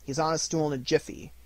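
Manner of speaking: plain reading voice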